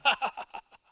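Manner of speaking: high mock sitcom laughter; mock audience laugh. Preacher imitating TV audience laughing, high mock laugh. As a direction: imitate a canned laugh track.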